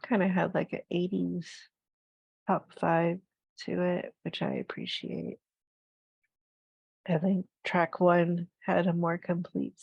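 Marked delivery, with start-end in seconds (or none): 4.2-5.4 whisper. Real, not acted. Quiet aside after opinion.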